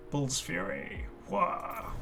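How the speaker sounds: normal talking voice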